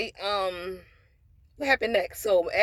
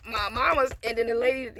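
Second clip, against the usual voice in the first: ordinary delivery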